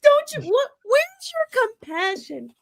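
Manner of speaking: mock-indignant high plea; mock outrage. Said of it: "they're like".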